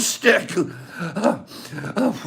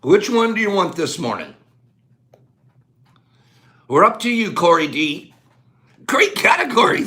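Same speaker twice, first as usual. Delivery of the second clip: ordinary delivery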